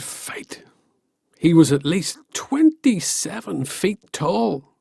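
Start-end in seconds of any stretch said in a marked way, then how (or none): 0.0-0.6 whisper. A narrator's reading. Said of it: "whispered end of sentence".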